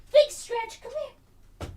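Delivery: big stretch sung up high, then falling; high puppy-talk voice. Real, not acted.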